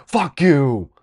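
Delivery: high, loud comeback; angry retort. Retold or imitated. Boy firing back at the friend teasing him, angry retort. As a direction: snap back loudly at a bully.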